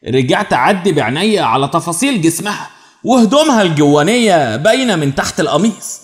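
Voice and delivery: plain reading voice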